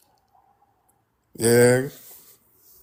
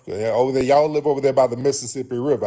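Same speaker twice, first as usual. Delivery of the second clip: ordinary talk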